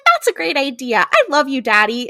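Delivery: lightly put-on sweet kid voice; little girl.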